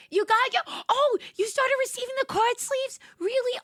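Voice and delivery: high, loud delighted squeal; squealing with excitement at a fan's news; excited disbelief. Real, not acted. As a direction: burst out in thrilled surprise. Thrilled viewer received merch.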